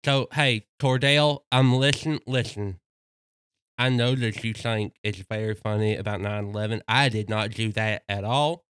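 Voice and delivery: slushy lisping dog voice, a bit low; lateral lisp. Retold or imitated. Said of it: "Wilder, do you have any comment on that?".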